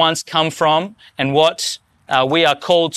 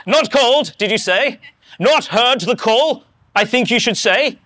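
put-on English accent; 6 semitones higher than usual. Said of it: "I imagine he's got an English accent".

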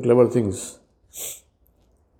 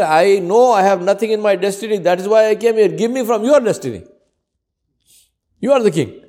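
raised, louder beggar's retort; bold beggar; 7 semitones higher, 3 dB louder than usual. Retold or imitated. Beggar answering the king from below the balcony, boldly. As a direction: talk back to the king boldly. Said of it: "He said".

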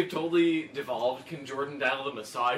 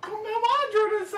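much higher, mock Japanese phone greeting. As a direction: squeak a jokey moshi moshi down the line. Pretending to answer a phone.

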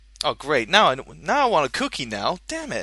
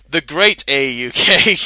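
grand title; 8 dB louder than usual. Acted. Reading the name grandly.

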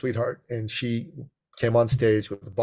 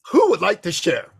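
raised, higher presenter's call; calling to an audience; 7 semitones higher, 5 dB louder than usual. Retold or imitated. Speaker asking members to share, calling out. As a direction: pitch it up and out to the room.

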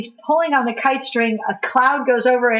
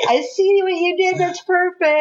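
higher, pleased knowing praise; delighted; 8 semitones higher than usual. Retold or imitated. Praising the filmmakers' clever nod, higher and delighted. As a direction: sound tickled, like you caught the trick. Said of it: "I was like".

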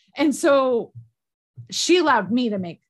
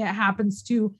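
ordinary talk

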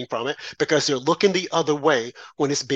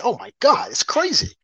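exasperated disbelief; 7 semitones higher than usual. Real, not acted. Exasperated at identity politics.

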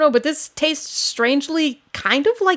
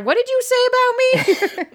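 mock offended; 11 semitones higher than usual. Mock offended retort in character.

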